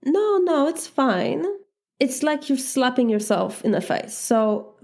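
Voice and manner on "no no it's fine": fake reassurance. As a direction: pretend it doesn't hurt, quickly and high. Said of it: "And you say".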